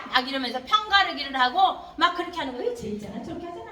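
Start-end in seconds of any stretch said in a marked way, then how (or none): 2.7-3.7 a child badmouthing a friend behind their back; gossiping whisper. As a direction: lean in and gossip about a friend. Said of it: "친구 뒤에서 막 흉보기도".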